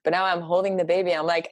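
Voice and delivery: ordinary talk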